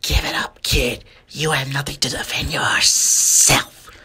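slow whispered stick-up threat; menacing whispered villain voice. Acted. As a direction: whisper it like a sneaky snake robber. Serpent threatens Bowshot.

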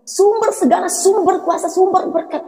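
retold declaration of faith, pitched high; declaring in faith. Daughter telling her parents God will provide, declaring in faith. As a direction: proclaim it high, in an insistent rising litany. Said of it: "saya katakan ini dengan iman".

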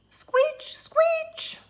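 high, whispered squeech; high owl squeak; owl screech. Acted.

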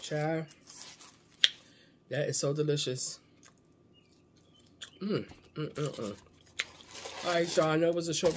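food in mouth; eating between words; talking while chewing. Real, not acted. Seen 3: Chews between bites, then lifts fried fish piece toward mouth.

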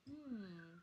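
soft, pondering hum; thoughtful. Acted. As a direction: hum quietly while thinking it over. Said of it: "thinking carefully".

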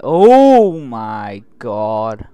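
loud, very drawn-out cry; horrified exclamation. Real, not acted. Player watching the horror scene, horrified exclamation. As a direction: stretch it out slowly, starting loud.

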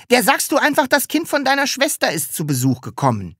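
put-on high childlike scheming; cheeky Sams. A narrator's reading. Sams coaching Taschenbier on a cover story, cheeky. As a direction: pipe up with an easy fix.

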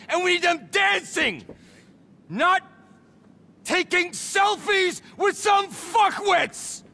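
high, exasperated yell, dragging out the words; angry shouting. Acted. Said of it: "You shouted at me a lot".

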